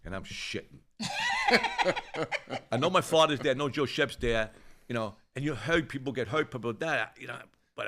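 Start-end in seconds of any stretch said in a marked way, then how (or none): 1.0-2.7 sudden high laugh, slightly louder; real high-pitched laugh; high burst of laughter. Real, not acted. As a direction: laugh out at how scared he was. Laughs at his own fear.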